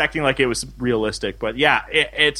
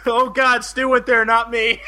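real laughter; 10 semitones higher than usual. Real, not acted. Laughing off the joke.

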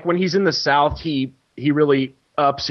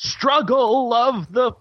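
much higher, very slow, put-on preachy voice; pandering Hillary; 10 semitones higher, slower than usual. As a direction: drag it out, oozing fake empathy. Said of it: "she's like".

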